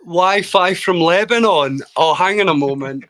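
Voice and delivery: incredulous and loud, stretching the words; mock-indignant surprise. Real, not acted. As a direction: echo the jibe loudly, taken aback. Reacts to teasing about his connection.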